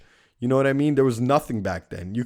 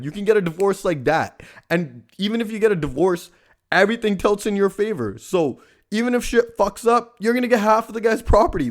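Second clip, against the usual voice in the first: building indignant rant; 9 semitones higher than usual. Real, not acted. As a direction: build from annoyed to exasperated, pushing higher each line. Rising indignant rant about crying bride.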